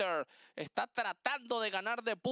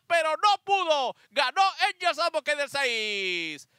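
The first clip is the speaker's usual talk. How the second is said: excited; 2 semitones higher, 6 dB louder than usual. Real, not acted. Race finish, shouting the call.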